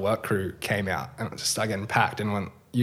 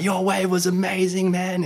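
higher, slow, gushing with excitement; psyched praise; 9 semitones higher, slower than usual. Retold or imitated. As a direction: gush over his wave, pitched way up. Psyched, praising a fellow surfer.

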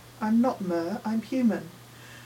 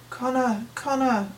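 inner scream, higher and a bit louder; 内心尖叫; 4 semitones higher, 4 dB louder than usual. A narrator's reading. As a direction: cry the name out inwardly, pitched up. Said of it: "I scream inside my head".

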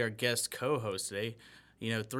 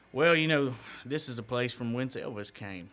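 Mississippi accent; 4 dB louder than usual. Shown as a demonstration. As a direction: show off a Mississippi-Memphis accent. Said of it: "mine's more of a Mississippi thing".

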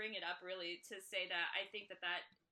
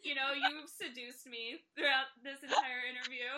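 mock seductive; 3 semitones higher, 8 dB louder than usual. Teasing mock seduction on podcast.

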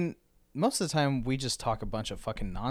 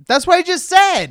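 high, loud exasperated shout; comically indignant yell; mock outrage; 13 dB louder than usual. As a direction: blow up at your friend in mock fury. Mock outrage at repeated story.

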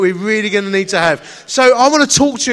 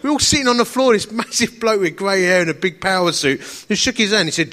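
usual speaking voice

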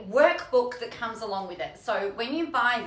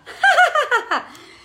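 high burst of laughter; 11 dB louder than usual. Real, not acted. Laughing at corner rubbish.

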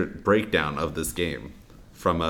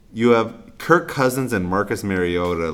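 ordinary talk, nothing marked

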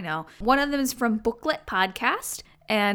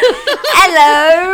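put-on British accent; 7 semitones higher, 12 dB louder than usual. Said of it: "I love that accent".

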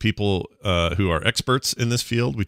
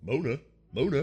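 softer, higher echo of MONA; Tony Danza; 7 semitones higher, 6 dB quieter than usual. Retold or imitated. Host copies co-host's joke pronunciation, Tony Danza impression. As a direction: try out "MOH-nuh" in a put-on voice. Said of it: "Tony Danza would pronounce it".

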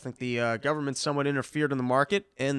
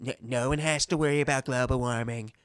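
mock Trump; 3 semitones lower than usual. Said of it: "he goes".

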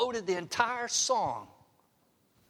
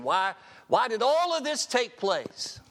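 pleading cry; 6 semitones higher, 5 dB louder than usual. Acted. Voicing our complaint to God.

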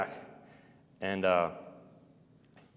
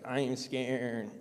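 slow, hushed and softer, dragging each word; slow quiet voice; 6 dB quieter, much slower than usual. Retold or imitated. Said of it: "kinda sounded like that".

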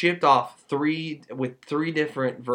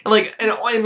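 ordinary delivery

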